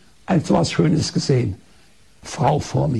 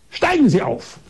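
higher, bossy order from the doctor; imperious command; 7 semitones higher than usual. Retold or imitated. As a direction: snap the order down like a haughty doctor. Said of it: "Da sagt sie ganz herrisch".

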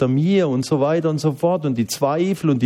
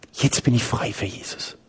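usual speaking voice

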